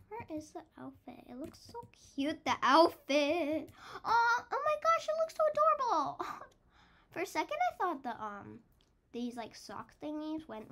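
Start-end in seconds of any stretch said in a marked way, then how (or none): 4.0-6.3 high, loud, fast gush; delighted gushing. Real, not acted. Child gamer seeing the finished outfit, delighted gushing. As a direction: squeal over how cute it is.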